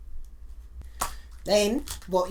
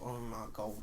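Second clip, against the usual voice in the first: hushed dismay; 9 semitones lower, 14 dB quieter than usual. Retold or imitated. Horror at spit in coffee.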